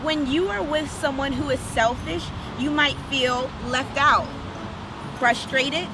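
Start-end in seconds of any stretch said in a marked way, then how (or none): none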